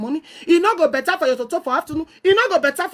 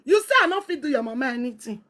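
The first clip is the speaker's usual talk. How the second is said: normal talking voice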